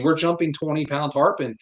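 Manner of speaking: ordinary talk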